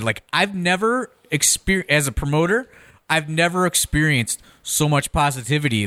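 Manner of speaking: usual speaking voice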